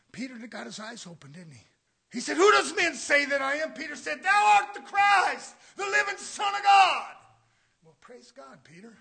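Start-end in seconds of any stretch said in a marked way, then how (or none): 2.1-7.2 loud, high fire-and-brimstone preaching; preaching shout. Acted. Preacher shouting to the church about Canaan. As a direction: thunder it at the congregation.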